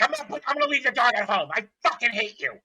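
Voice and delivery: fast, high, loud retold tirade; mock angry client. Client raging at trainer before leaving, mock angry. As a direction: imitate her rushing out a loud threat. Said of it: "She's like".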